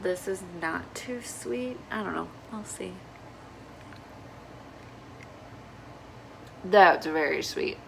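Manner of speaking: sipping between words; sipping hot coffee. Real, not acted. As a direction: talk while slurping coffee. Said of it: "Pardon the slurping".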